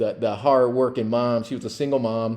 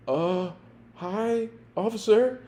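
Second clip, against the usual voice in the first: put-on meek kid; 10 semitones higher than usual. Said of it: "He's like".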